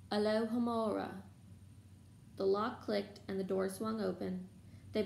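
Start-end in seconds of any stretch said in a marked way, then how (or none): none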